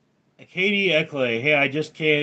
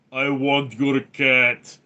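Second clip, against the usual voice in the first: slow, drawn-out mock-Russian; Soviet Russian accent; 3 dB louder, slower than usual. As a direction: put on a heavy Russian accent, stretching each word. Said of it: "Soviet Union accent made".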